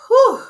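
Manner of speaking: high, loud exhaled whew; relieved whew. Real, not acted. Reviewer after a very lengthy review, relieved whew. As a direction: blow out a big high "whew".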